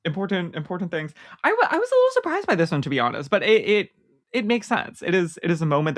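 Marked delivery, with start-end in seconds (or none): 1.4-2.8 much higher, rushed surprise; surprised. Real, not acted. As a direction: blurt it out, genuinely surprised. Reacting to award winner.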